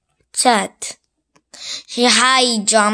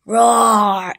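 monster roar. Acted. Monster roars at goat.

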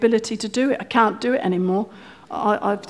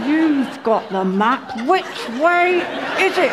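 through gritted teeth; slower than usual. Retold or imitated. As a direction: hiss it through clenched teeth. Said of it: "talking to me through his teeth".

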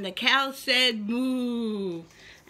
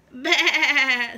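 laughing sheep bleat; 7 dB louder than usual. Acted. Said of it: "said the sheep".

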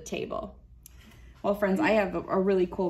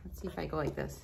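usual speaking voice

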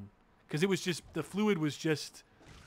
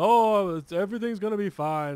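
higher, loud-starting imitation of hospital staff; mock reassurance; 5 semitones higher, 5 dB louder than usual. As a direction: breezily parrot the staff's soothing line. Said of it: "And they go".

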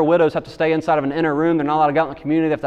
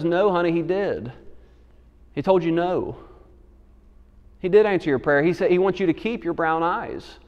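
gentle mother; 5 dB quieter than usual. Retold or imitated. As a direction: comfort her gently, hushed. Mother gently comforts daughter.

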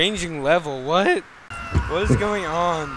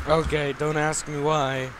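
normal talking voice

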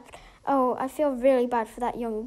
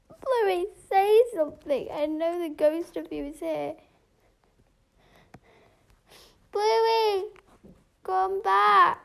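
calling out; 5 semitones higher than usual. Pretend. Calling to the ghost at grave.

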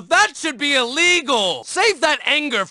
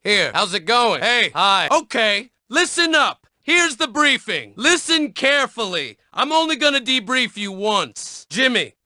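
ordinary delivery

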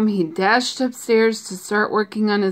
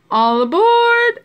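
high, loud drawn-out conductor's call; 13 semitones higher, 6 dB louder, slower than usual. A narrator's reading. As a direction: call everyone on board, stretching the last word. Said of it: "Oscar called".

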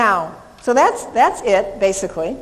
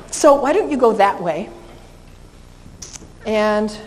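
ordinary delivery

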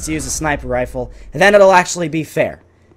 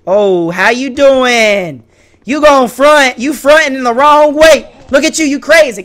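high, loud playful taunting; mock trash talk; 9 semitones higher, 7 dB louder, slower than usual. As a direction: jeer at your opponent in a put-on high voice.

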